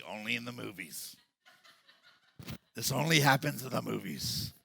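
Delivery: a touch of grumpy resignation; mock grumpy. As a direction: mutter it like a disappointed cynic. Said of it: "we become grumpy".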